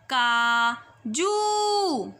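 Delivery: काजू with the ऊ drawn out slowly; stretched vowel. Shown as a demonstration. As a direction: hold the ऊ in काजू. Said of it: "ज को बड़े ऊ की मात्रा जू".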